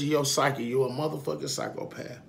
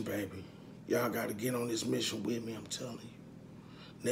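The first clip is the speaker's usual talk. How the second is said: soft heartfelt; 4 semitones lower, 10 dB quieter than usual. Real, not acted. Man telling viewers about his mission, soft and heartfelt. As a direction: let it sink in softly. Emotional reflection after family loss.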